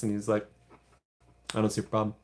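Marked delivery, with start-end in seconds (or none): 1.5-2.1 lightly voiced Randy; a hint of unbothered Randy; Randy impression. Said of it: "It's my best Randy impression".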